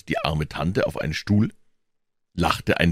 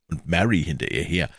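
plain reading voice

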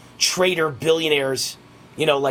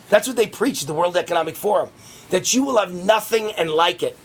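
usual speaking voice

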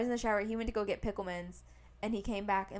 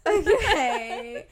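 loud, high, laughing through the word; laughing okay; 7 semitones higher, 11 dB louder than usual. Real, not acted. Friend urges her to dive in, real laughing okay. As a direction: burst out laughing as you agree.

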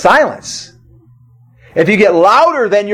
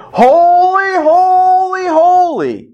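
much higher ringing angelic cry; angels crying holy; 11 semitones higher, much slower than usual. Retold or imitated. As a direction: proclaim holy like an angel host. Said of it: "the angels will be there going".

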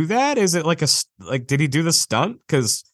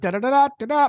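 high da-da trumpet imitation; imitated trumpet fanfare; 11 semitones higher, much faster than usual. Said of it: "it should be like the trumpet thing".

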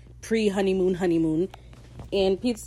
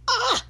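very high short scream; delighted squeal; 3 dB louder than usual. Real, not acted. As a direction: squeal at a favourite line. Delighted reaction to the line.

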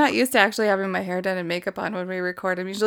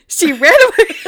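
laughing; 9 semitones higher, 6 dB louder than usual. Retold or imitated. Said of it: "you're like".

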